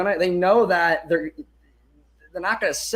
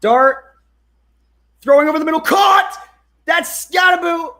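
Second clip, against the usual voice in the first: high, loud shout building to the catch; excited play-call shout; 10 semitones higher, 7 dB louder than usual. Real, not acted. Fan calling a completed pass live, excited shouting. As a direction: shout the catch as it happens, voice leaping up.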